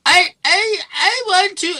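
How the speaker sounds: loud, very high falsetto stammer; hammed-up squeaky caller; mocked telemarketer. Phone salesperson starting the pitch, loud mocking falsetto. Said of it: "No, they go".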